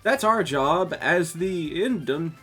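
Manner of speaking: high, keen club president; eager girl voice. Acted. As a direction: lilt it out with zeal.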